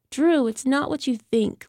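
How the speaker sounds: high pleading whine; whining. Acted. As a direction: whine it like a caught addict. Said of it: "Lenora whined".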